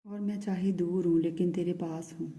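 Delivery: ordinary talk, nothing marked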